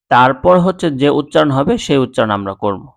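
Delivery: usual speaking voice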